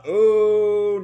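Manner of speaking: sudden loud high alarm. Real, not acted. Reader reacting to a manga panel, loud alarmed gasp. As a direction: blurt it out in real alarm.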